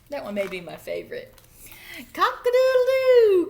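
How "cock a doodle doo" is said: high, loud crowing; very loud high cock-a-doodle-doo; rooster crow. A narrator's reading. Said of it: "crowed Rooster".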